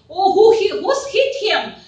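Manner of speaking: high, loud alarmed outburst, slowing on the end; shocked question. Retold or imitated. Detective sees the bleeding officer, shocked and high. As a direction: gasp it out on seeing the blood. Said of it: "like he asked".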